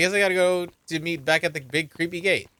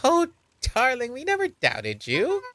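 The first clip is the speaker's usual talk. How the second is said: high-pitched, slightly drawn-out doting mum; doting mother character; 10 semitones higher, slower than usual. Acted. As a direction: voice a fussing mother in a much higher pitch.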